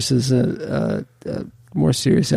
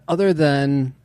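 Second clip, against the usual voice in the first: normal talking voice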